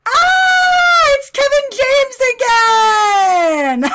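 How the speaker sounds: long, high, drawn-out scream; shrieking hilarity. Real, not acted. Commentator spotting a Kevin James lookalike, shrieking hilarity. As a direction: scream it out, stretching words, then laugh.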